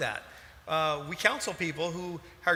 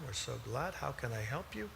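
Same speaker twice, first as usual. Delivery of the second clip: much softer, low, even counselor voice; soft-spoken counselor; 5 semitones lower, 11 dB quieter than usual. Retold or imitated. Husband mimicking their marriage counselor, much softer and lower. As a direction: mimic the calm counselor, barely above a murmur. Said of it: "just talked to us like this the entire time".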